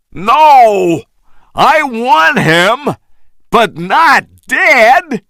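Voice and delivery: high, slow emphatic shout; insistent slow shout. A narrator's reading. Carl overruling the shooting, insistent shout. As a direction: overrule them firmly. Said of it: "Carl shouted".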